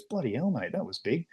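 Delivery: understated Aussie remark, tossed off softly; dry local-accent contrast to American gushing; laconic Aussie accent. Retold or imitated. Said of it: "But when somebody just goes".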